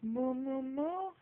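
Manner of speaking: put-on high sing-song moo for a toddler; cow moo. Shown as a demonstration. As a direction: moo like a cow to teach a baby. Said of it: "what does the cow say?".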